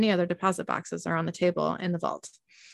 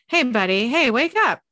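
higher, louder, insistent calling; raised voice trying to wake someone; urgent wake-up call; 5 semitones higher, 6 dB louder than usual. A narrator's reading. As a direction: call out to rouse him. Trying to wake a dazed friend.

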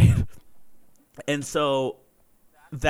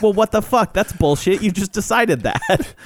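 put-on higher, outraged kid's protest, slowing at the end; indignant kid; 6 semitones higher than usual. Kid told he can't play protests to the enforcer, indignant. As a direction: complain like a wronged kid in a playground game.

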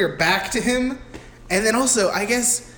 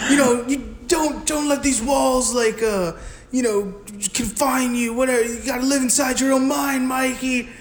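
higher, put-on mentor voice; Danny Trejo; 6 semitones higher than usual. Guard advising locked-up Michael, mock mentor voice pitched up. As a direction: imitate a guard giving halting life advice. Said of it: "Danny Trejo walks up".

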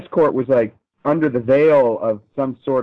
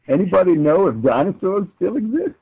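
coy; 7 semitones higher than usual. Pretend. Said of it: "now I'm gonna be coy with you".

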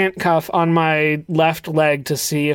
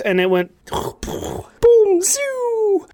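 gunshot sound effect; much slower than usual. Retold or imitated. Acting out the gunshot.

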